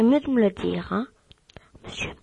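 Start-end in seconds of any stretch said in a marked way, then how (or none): 1.8-2.1 hushed, coaxing whisper; a pretend host whispering to a guest. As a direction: whisper the request as if wheedling. Host pleads for the guest's name.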